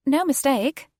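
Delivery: high, firm British reassurance; prim British accent. Acted. Flight attendant insisting to the passenger in a prim British accent. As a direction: settle it politely like an English stewardess. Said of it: "in her prim British accent".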